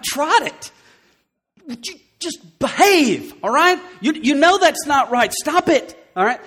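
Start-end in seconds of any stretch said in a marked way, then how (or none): 1.7-6.4 raised, stern mock scolding; half-hearted parent telling a child off; mock parent command. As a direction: scold a kid with a lightly raised voice. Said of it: "that's what you want to do".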